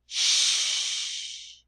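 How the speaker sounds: long sh hiss, no voice; sh like hushing; hushing sh sound. Shown as a demonstration. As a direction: hold out the sh. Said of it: "your special sound is".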